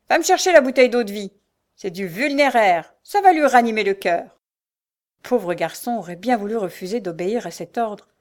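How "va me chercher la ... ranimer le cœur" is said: loud bossy command, fast at first; shouted order. A narrator's reading. Mother sends her son for brandy, shouting. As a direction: order him about at full voice. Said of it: "s'écria-t-elle".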